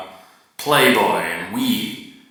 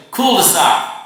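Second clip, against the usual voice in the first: Cul-de-sac, louder and higher; emphatic cul-de-sac; 7 semitones higher, 5 dB louder than usual. Shown as a demonstration. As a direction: announce cul-de-sac proudly. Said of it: "Not dead end".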